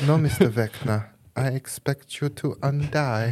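put-on slow theatrical villain menace in a German accent; Bond-villain German accent, drawn out. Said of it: "my villains are always German".

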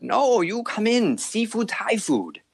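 put-on high, slow accented sales pitch; maitre d' voice. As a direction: coax them in, drawn out. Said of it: "And he's like".

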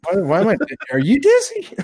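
high laughing question. Real, not acted. Dizzy after the workout, laughing.

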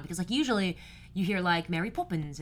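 normal talking voice

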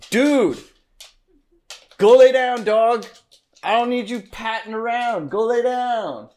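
loud, high real shout at the dog; yelling at dog. Real, not acted. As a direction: chase the dog off with a bark of a yell. Yelling at the dog mid-recording.

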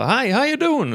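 high-pitched, sing-out office greeting; ropad hälsning. Retold or imitated. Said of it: "kom kollegan in som vanligt så". (ljust, glatt hälsningsrop)

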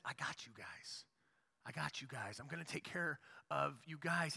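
low, hushed, reassuring God; tender God voice. Retold or imitated. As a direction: soften right down and reassure. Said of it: "He says".

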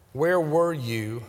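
where were you drawn out and slow; slow plea. Acted. A grieving believer asking God, drawn-out plea. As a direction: ask it slowly as an aching plea.

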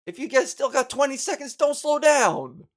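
shouted high and loud, slowing hard on the last words; mock yell at drivers. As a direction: bark the order out the car window. Yelling at slow drivers.

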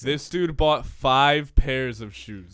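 loud, slow, spelled-out outrage; loud disbelief. Real, not acted. Podcaster exposing co-host's five pairs of shoes, loud disbelief. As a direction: announce it word by word, loudly. Incredulous repeat to the audience.

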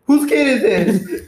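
loud, drawn-out call; retold angry demand. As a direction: call it out to the whole bus. Retold bus driver demanding loudly.

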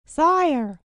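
high, loud outcry; exclaiming. A narrator's reading. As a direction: call out in mock alarm to a king. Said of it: "she exclaimed".

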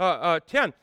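ordinary delivery

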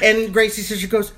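ordinary delivery

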